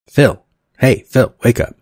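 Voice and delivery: loud wake-up call. Acted. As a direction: shout him awake from across the room. Holly shouting to wake Phil.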